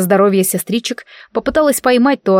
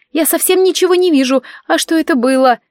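high, coy babble; babbling girl; 8 semitones higher than usual. Acted. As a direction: flutter innocently. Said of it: "пролепетала она".

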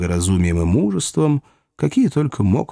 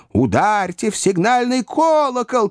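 shouted alarm; 11 semitones higher than usual. A narrator's reading. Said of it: "крикнуло несколько голосов".

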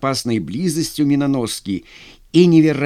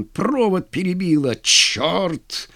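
higher, exasperated cry; cursing; 5 semitones higher, slower than usual. A narrator's reading. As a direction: curse at the failure. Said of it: "выругался Скрыдлов". (ругаясь, с досадой)

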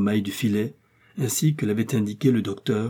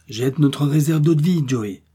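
raised, louder order to a companion; urgent exclamation; 4 semitones higher, 5 dB louder than usual. Acted. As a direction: call out the order. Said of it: "s'écria le docteur". (ordre lancé d'une voix forte)

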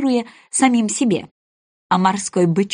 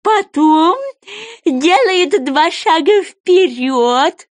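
high, loud creature voice, drawn out; eager goby; 9 semitones higher, 5 dB louder, much slower than usual. Acted. Goby calls the sea animals to line up, eagerly. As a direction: call out the list high and insistent.

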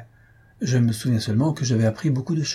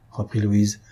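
ordinary delivery, nothing marked

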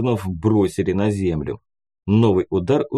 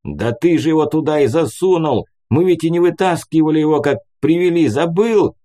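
raised, indignant retort from a man caught off guard; flustered, higher-pitched comeback; taken aback; 6 semitones higher, 4 dB louder than usual. A narrator's reading. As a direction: answer an unfair accusation in startled protest. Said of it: "ответил опешивший от наезда Мур".

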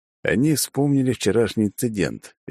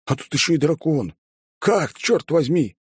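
astonished; 9 semitones higher than usual. A narrator's reading. Guest marvels at dragon.

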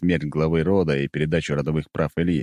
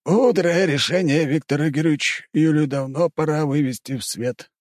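approving; 10 semitones higher than usual. A narrator's reading. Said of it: "одобрительно кивнул Дворецкий".

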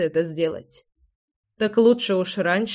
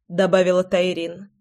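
ordinary delivery

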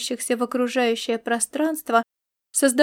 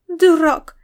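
loud, higher-pitched huffy insult; offended outburst; 5 semitones higher, 7 dB louder than usual. A narrator's reading. Star cat offended at being shooed away, huffy outburst. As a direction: snap it out, hurt. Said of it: "обиделась звёздная кошка".

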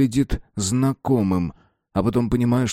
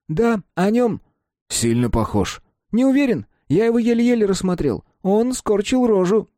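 put-on high boyish voice; Mark's voice; 12 semitones higher than usual. A narrator's reading. Mark telling Simon it was fun, high boyish voice. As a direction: speak as the young friend Mark.

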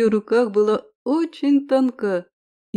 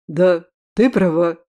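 low booming voice; deep bass; 4 semitones lower, 4 dB louder than usual. A narrator's reading. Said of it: "пробасил мужчина".

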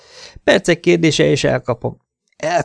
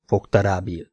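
plain reading voice

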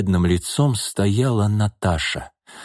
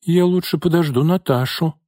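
higher, lightly voiced stubborn little girl; stubborn child; 8 semitones higher than usual. A narrator's reading. Daughter refusing to go with mother, mildly stubborn. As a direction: dig in like a small child. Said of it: "упрямо заявила девочка".

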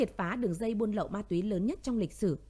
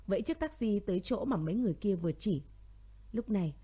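ordinary delivery, nothing marked

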